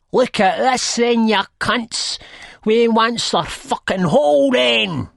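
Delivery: high, loud rowdy roar; full-throated high-pitched yell. A narrator's reading. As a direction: roar it across at the whole gang. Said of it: "she roars at us".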